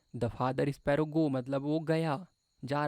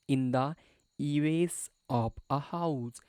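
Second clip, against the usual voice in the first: slow reading; much slower than usual. Shown as a demonstration. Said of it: "ईवेस मतलब क्या होता है?".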